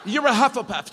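high, mock-solemn proclamation; Sorting Hat. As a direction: announce the verdict high and playful. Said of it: "The Sorting Hat has spoken".

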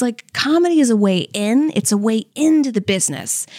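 ordinary talk, nothing marked

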